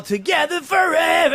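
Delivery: high mock crowd yell. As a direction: yell it like the whole arena. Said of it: "they all yell".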